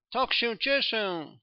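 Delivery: a cry of joy, high and drawn out; light up as you spot the lost cane; joyful exclamation. A narrator's reading. Young doctor finding his cane, joyful high cry. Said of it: "z okrzykiem radości".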